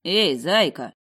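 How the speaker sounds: light male voice calling. A narrator's reading. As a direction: call after her teasingly. Crayton calls after her.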